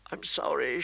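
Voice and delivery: mildly tearful, small voice; a touch of sobbing; sobbing apology. A narrator's reading. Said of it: "she sobbed".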